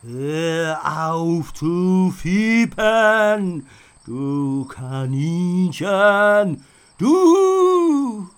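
the haunted knight snaps at the skunk, calling him a rabbit; ghost knight scolding. A narrator's reading. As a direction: sneer down at the tiny creature.

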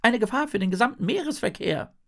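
very high, indignant fish voice; exasperated little fish. A narrator's reading. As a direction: complain loudly in a squeaky pitch. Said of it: "Der kleine Fisch verdreht die Augen". (hohe, empörte Fischstimme)

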